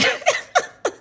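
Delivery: high-pitched helpless giggles; laughing. Real, not acted. Podcaster cracks up at the publicist statement, laughing. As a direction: break up laughing mid-statement. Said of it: "I'm sorry, I'm laughing".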